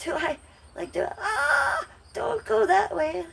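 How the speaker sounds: high frightened cries, voice cracking; squealing scared at the spider; panicked shriek. Real, not acted. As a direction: shrink back and squeal in real fear.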